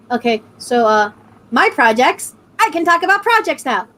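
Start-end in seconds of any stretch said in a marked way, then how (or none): none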